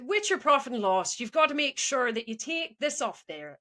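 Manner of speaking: put-on Irish lilt; Irish accent. Teacher reading profit and loss to class, Irish accent. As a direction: attempt a rough Irish accent. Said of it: "Can you do Irish?".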